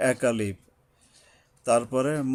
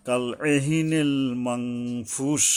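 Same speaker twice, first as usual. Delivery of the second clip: slow, measured tajweed chant; Quran recitation; much slower than usual. Shown as a demonstration. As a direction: recite slowly, holding nasals and long vowels. Recites verse being taught. (তাজবিদসহ ধীরে কুরআন তিলাওয়াত)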